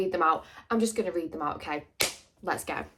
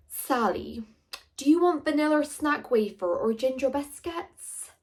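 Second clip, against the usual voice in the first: higher put-on voice of the customer; imitating the Costa customer; 6 semitones higher than usual. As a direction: mimic the man in Costa, pitched up. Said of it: "that's how he spoke".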